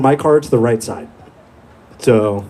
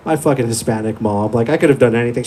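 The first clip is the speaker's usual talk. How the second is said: ordinary talk, nothing marked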